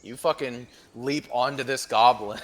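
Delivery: usual speaking voice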